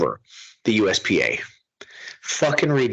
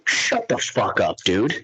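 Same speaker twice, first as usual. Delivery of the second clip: usual speaking voice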